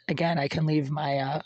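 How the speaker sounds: ordinary delivery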